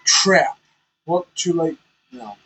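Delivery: ordinary delivery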